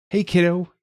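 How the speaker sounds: bright, high cheerful hello; beaming greeting. A narrator's reading. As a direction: greet her with a big grin. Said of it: "he said, beaming".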